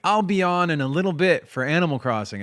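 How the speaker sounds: upbeat quote of oneself; cheery announcement. Retold or imitated. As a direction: call it out brightly to the chat. Retelling own cheery chat announcement.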